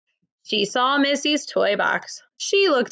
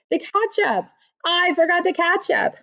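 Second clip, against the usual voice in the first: higher, bright realization of a slip; sudden realization; 7 semitones higher than usual. Acted. Amelia remembering the ketchup for Missy, higher sudden realization. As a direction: pitch up as if catching a mistake.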